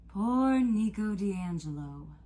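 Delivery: slow, drawn-out mock pity; a god's lofty, disappointed sigh of words; tinged with disappointment. A narrator's reading. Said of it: "tinged with disappointment".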